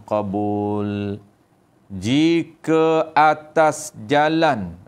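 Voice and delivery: slow, drawn-out reading of a religious text; measured recitation with long held endings; kitab recitation. A narrator's reading. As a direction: stretch each phrase as in a study circle. Reads the kitab text aloud.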